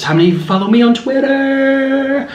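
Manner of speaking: high sing-song tease; high playful question. Real, not acted. Tease to the camera.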